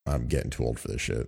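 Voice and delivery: low, soft, world-weary grumble; quick, dropped-down fed-up aside; Commissioner Gordon. Acted. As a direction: grumble under it like a tired veteran cop. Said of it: "Commissioner Gordon is just like".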